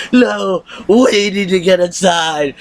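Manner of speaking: mocking Deanna again. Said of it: "She's like".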